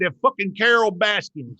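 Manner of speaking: twang it out like a Florida local; Florida accent. Shown as a demonstration. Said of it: "You gotta use that Florida accent".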